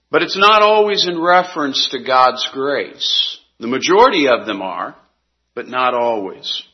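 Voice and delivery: ordinary talk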